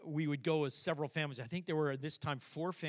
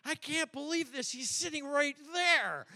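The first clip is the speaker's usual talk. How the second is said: amused disbelief; 9 semitones higher than usual. Retold or imitated. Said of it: "we're just going".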